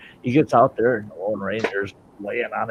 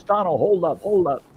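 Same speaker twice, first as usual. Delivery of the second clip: high-pitched acted call; calling to stop; 9 semitones higher than usual. Lone Ranger tells Tonto to pull up, high urgent call. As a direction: call out to a riding companion.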